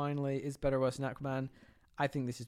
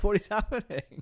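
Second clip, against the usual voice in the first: high, louder mock bewilderment; baffled viewer; 8 semitones higher, 5 dB louder than usual. Viewer watching a weird camp audition, baffled. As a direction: play it lightly incredulous, pitched up.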